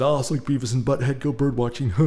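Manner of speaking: doofus cartoon teen with a dumb chuckle; Beavis and Butthead. Birdwatcher joking to strangers, cartoon doofus impression. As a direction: chuckle dopily through the joke. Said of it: "I made a Beavis and Butthead joke".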